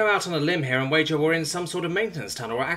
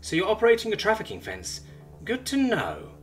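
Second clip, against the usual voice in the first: higher, sharp disgusted jab; 11 semitones higher than usual. Acted. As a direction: spit it out with distaste. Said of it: "with disgust in her words".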